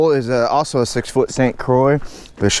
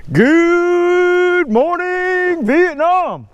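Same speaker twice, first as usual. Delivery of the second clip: high, stretched radio DJ shout; Robin Williams impression; much slower than usual. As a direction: belt it out like a morning DJ. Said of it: "My Robin Williams impression".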